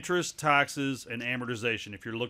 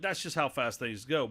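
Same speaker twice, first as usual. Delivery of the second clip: ordinary talk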